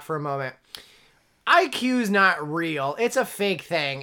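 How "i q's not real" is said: slow, weighty, each word spaced out; slow emphatic. Real, not acted. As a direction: lay it down slowly for the guys out there. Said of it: "we should have a talk".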